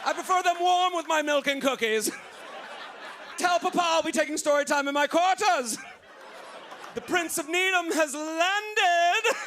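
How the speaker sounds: high, prissy royal whine; spoiled prince. Pretend. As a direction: play a pampered little prince. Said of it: "You sound like a spoiled prince".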